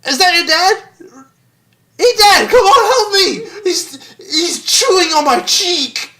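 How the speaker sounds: high, loud, frantic comic shouting; hammed-up plea for help. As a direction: yell in mock agony while being chewed. Acts victim begging for help.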